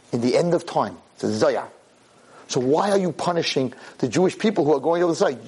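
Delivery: ordinary talk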